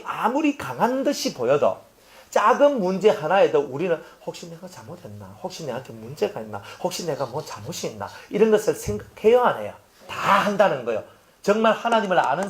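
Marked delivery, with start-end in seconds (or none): 4.2-8.1 anxious inner thought. Retold or imitated. Worried self-questioning inner thought.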